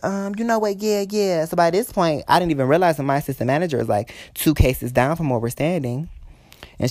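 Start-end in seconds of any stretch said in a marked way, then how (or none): 0.0-1.4 high-pitched mock reply; mimicking dismissive customer. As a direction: imitate her brushing you off, pitched up. Imitating the customer's curt answer.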